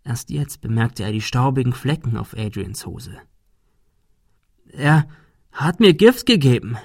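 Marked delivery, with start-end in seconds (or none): 4.7-6.8 higher, halting confession; distressed. A narrator's reading. Adrian telling Thomas he was poisoned, distressed. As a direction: force it out, frightened.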